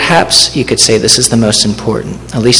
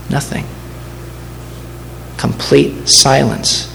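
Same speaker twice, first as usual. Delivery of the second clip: hushed dramatic pause; 7 dB quieter, slower than usual. Acted. Stressing the Lord's silence.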